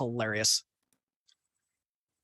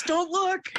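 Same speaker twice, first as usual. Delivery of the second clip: high teasing voice; 13 semitones higher than usual. Acted. Teasing command, far voice.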